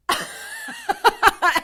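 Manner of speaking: high, scornful cackle; mocking high laugh. Witch scoffing at the hunter's arrest, mocking high cackle. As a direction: laugh off the threat with a shrill cackle.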